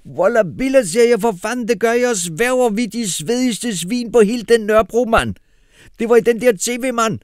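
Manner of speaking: high, loud street slang voice; Kasim shouting. A narrator's reading. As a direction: yell it like a hyped Nørrebro kid. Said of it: "råbte Kasim".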